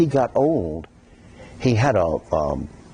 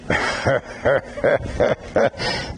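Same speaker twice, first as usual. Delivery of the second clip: breathy bass laugh; 2 semitones higher than usual. Shown as a demonstration. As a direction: mimic women who laugh in the bass. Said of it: "They go in the bass".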